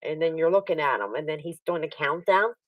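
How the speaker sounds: ordinary delivery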